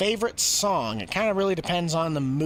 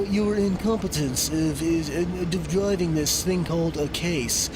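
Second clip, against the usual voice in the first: slow, hammed-up snooty rich gentleman; richer voice. Streamer mocking a cheap tractor as a pompous rich gentleman, slow. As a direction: look down your nose at the poor farmer, drawn out. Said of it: "A richer voice".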